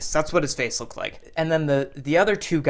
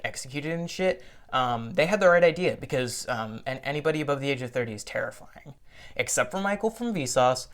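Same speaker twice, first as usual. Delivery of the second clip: ordinary delivery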